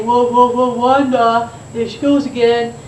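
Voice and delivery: mocking kid. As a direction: jeer at her like a cheeky schoolkid. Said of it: "had the nerve to say".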